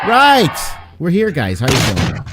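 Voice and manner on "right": loud, high burst of hype; hyped shout. Real, not acted. DM greeting the players on stream, excited shout. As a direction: shout it to kick things off.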